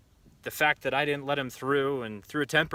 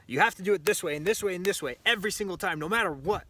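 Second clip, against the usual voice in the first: higher, faster mock lecturing insistence; bossy rulebook voice; 6 semitones higher, faster than usual. The world telling shooters how to train, mock insistent. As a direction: rattle it off like a nagging know-it-all. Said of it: "a lot of the world will tell you".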